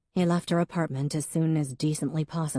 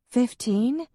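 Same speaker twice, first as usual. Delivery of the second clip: much higher, rising FIFTEEN; surprised question; 8 semitones higher, 3 dB louder than usual. A narrator's reading. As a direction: toss it back in disbelief. Em reacts with disbelief.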